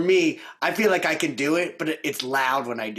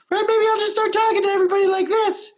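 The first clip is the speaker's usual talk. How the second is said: very high falsetto, quick and louder; head voice; 5 dB louder, faster than usual. Shown as a demonstration. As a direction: squeak it out in falsetto. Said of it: "Work on my head voice".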